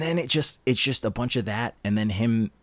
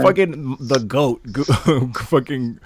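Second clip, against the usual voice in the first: laughing excitedly; 3 semitones higher, 5 dB louder than usual. Real, not acted.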